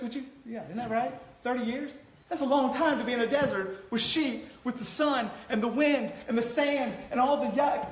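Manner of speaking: ordinary talk, nothing marked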